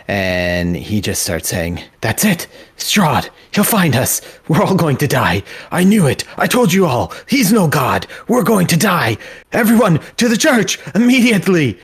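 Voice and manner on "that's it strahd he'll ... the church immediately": high, loud frantic cries; panicking patron. Acted. Panicked patron warns the tavern, shrieking in fear. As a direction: shout in rising terror. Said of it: "his panic increases".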